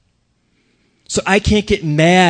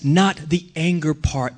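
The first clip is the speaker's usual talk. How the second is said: ordinary talk, nothing marked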